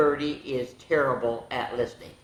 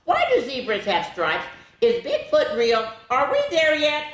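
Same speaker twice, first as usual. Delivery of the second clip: higher, questioning bird voice; curious bird; 11 semitones higher, 3 dB louder than usual. Acted. The bird pestering with questions, high and curious. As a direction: fire off questions like a restless kid.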